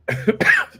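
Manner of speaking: sudden harsh choking cough; coughing from vape. Real, not acted. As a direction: cough hard, smoke down the wrong pipe. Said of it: "that vape went down the wrong pipe".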